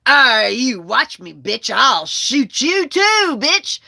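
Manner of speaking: imitating a loud, slow, defiant threat; mock the enraged cousin at high volume; angry threat. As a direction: draw out the threat, loud and rising in pitch. Acted cousin threatening to shoot.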